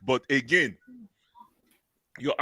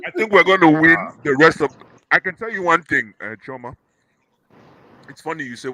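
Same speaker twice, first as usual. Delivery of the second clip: talking through popcorn; words round a mouthful of popcorn; mouth full; 2 semitones higher, 7 dB louder than usual. Real, not acted. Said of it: "Sorry, I'm eating popcorn".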